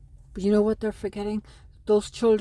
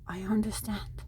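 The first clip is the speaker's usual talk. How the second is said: whispering a tender plea to her estranged sons; 8 dB quieter than usual. Real, not acted. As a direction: drop to a whisper, almost to herself. Pleading softly to her sons.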